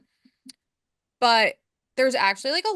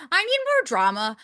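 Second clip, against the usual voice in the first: lightly put-on higher voice; hammed-up self-parody; 8 semitones higher, 4 dB louder than usual. Podcaster mimicking herself as a drama-hungry viewer, hammed-up. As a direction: playfully act out craving drama, pitched up. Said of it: "here's me".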